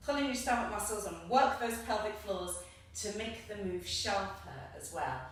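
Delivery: normal talking voice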